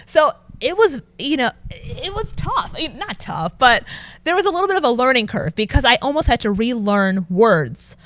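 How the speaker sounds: ordinary talk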